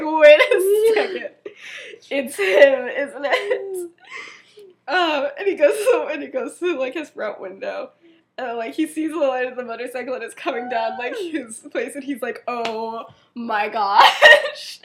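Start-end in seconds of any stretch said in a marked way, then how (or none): 0.0-3.9 high, loud, slow laughing realization; cracking up as it dawns on him. Retold or imitated. Remus realizing Sirius has come, laughing. 12.5-14.9 very slow, loud oh my gosh through laughter; drawn-out laughing gasp. Retold or imitated. Remus seeing the motorcycle light arrive, laughing.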